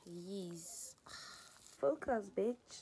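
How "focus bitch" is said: self-scolding snap. Real, not acted. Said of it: "scolds herself while cooking".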